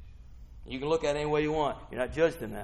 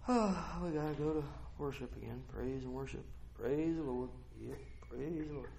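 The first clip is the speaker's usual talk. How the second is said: bored reluctant worshipper; 12 dB quieter than usual. Acted. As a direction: drag it out like a dreary chore.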